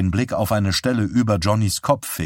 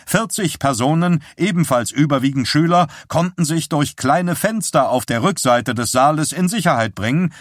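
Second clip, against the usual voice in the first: a bit higher, urgent reporting of bad news; a hint of a rattled man passing on grim news; lightly voiced shaken husband; 7 semitones higher than usual. A narrator's reading. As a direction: relay the disaster, tense and hurried. Roger reports fire news.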